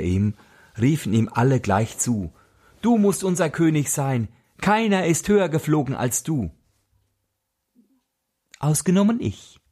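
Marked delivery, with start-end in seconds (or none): 2.8-6.5 birds hailing their king. Acted. The birds call up to eagle. 8.6-9.6 high, cheeky little cry from a hiding bird; tiny bird crying out. Acted. Little wren pipes up at the birds, high and cheeky. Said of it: "schrie der kleine Kerl ohne Namen".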